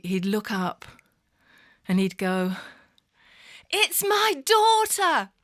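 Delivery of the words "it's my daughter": high, drawn-out loud call, voiced as the father; imitated father's cry. Confused dad announces his daughter, high drawn-out call. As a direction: cry it out slowly, pitched way up. Retold exchange with father in care home.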